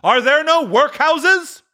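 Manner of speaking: very high, slow, loud scorn; scornful spirit. Acted. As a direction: throw back his words harder. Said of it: "with his own words".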